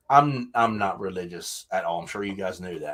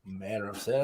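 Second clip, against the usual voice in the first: normal talking voice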